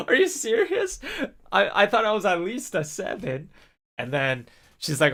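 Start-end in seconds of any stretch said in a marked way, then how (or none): 0.1-3.5 high-pitched, louder, mock-offended protest; indignant disbelief. Translator protesting her six point five, high and offended. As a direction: squeal it like you can't believe the score. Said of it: "And she was like".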